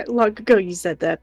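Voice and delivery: high, loud, laughing through the words. Real, not acted. Reassuring Robin in chat, laughing. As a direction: let a laugh break into the reply.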